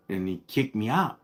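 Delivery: ordinary talk, nothing marked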